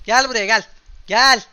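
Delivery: loud gleeful hunting call; excited shout. Real, not acted. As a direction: yell at the fleeing enemy to come back. Chasing enemy, calling out.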